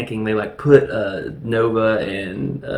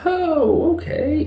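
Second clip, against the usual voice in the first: mock surprise; slower than usual. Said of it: "They're like".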